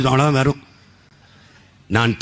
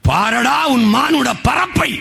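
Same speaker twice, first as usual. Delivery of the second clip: slow, high declaimed verse; poem declaimed; 8 semitones higher, slower than usual. Acted. Orator quotes the poet to the crowd, declamatory call. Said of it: "பிறந்த பட்டாளம்ங்கிறான்".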